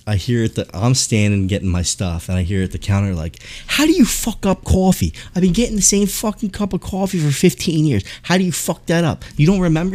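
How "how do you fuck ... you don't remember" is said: high-pitched mock tirade of an irate customer; imitated angry yelling. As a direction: rant in a high, put-on outraged voice. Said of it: "He's yelling at the guy".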